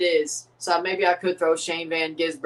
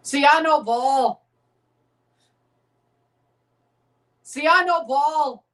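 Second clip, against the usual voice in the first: cocky boast; 7 semitones higher, 5 dB louder, slower than usual. Real, not acted. Boasting to viewers.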